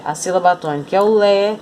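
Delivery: ordinary talk, nothing marked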